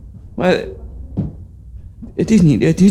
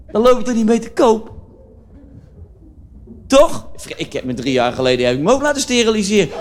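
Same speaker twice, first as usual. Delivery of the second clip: raised-pitch cheerful comic character voice; Dirk Dickenberg character; 2 semitones higher than usual. Acted. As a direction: play the tactless joker Dirk, pitched up. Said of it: "dat is een typetje".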